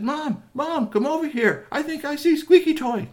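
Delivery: put-on high-pitched child calling a parent; excited calling. Three T calling Mom to the telescope, excited. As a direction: call out urgently in a high, excited voice. Three T calls mother over.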